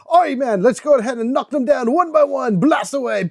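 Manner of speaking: a clumsy attempt at a Scottish brogue; rallying the team in a mock Scottish accent; bad Scottish accent. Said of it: "I am terrible with that Scottish accent".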